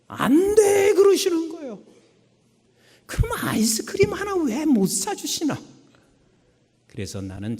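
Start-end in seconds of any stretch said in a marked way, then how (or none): 0.1-1.8 high, louder flat refusal; mother refusing her begging son; cold mother's no. Retold or imitated. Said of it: "우리 어머니는 진짜 냉정했습니다". 3.1-5.6 put-on whining child. Child begs mother for ice cream.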